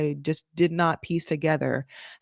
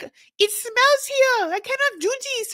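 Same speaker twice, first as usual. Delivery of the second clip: high, whining put-on French accent; mock French-accented wail; imitated French accent. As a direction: complain in a hammed-up French accent. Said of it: "every time I do this accent".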